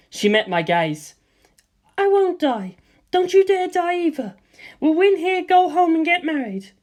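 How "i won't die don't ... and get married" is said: high, bright-voiced young woman, confident; high female character voice, calm and sure; Lisa Lisa's voice. A narrator's reading. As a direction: lift into her high voice and reassure.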